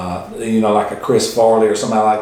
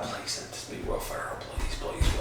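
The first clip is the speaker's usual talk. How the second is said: pleading whisper, much softer; whispered plea; 15 dB quieter than usual. Retold or imitated. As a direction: breathe it out like a silent prayer. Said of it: "I was like".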